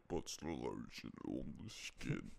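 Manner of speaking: low, slow, menacing creep voice; Buffalo Bill quote. Retold or imitated. Doing Buffalo Bill's creepy line low and slow.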